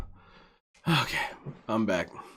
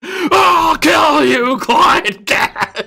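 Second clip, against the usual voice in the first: slow, much higher, louder hammed-up yell; mock threat shout; 11 semitones higher, 8 dB louder, much slower than usual. Streamer imitating Phil yelling at Clyde Cash, hammed-up shout. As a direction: drag out the threat in a mock rage. Quoting Phil's angry clip.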